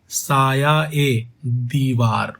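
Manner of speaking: "साया ए दीवार" said very slowly, linking ए; slow "साया ए दीवार" demo; slow pronunciation. Shown as a demonstration. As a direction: model the izafat slowly. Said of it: "उच्चारण करो".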